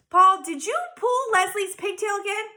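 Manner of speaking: high, loud accusing question; sharp teacher challenge; stern teacher. A narrator's reading. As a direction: demand an answer from the boy. Teacher demands.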